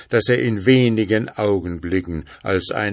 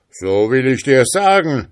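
loud, raised threatening voice; fearsome spirit calling; 6 semitones higher, 8 dB louder than usual. A narrator's reading. Spirit answers the fearless schoolboy, loud menacing call. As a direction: proclaim it as a towering spirit. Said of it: "rief der Geist".

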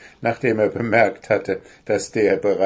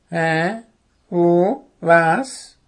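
high, louder dazed questions; bewildered owl; 8 semitones higher, 5 dB louder, much slower than usual. A narrator's reading. Owl understands nothing, asking in bewilderment. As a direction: blurt short puzzled owl questions.

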